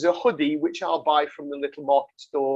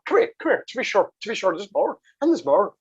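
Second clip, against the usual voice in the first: a hammed-up bad Irish accent; 3 semitones higher than usual. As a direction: throw on a cheesy, overdone Irish brogue. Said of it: "worst accent I've ever heard".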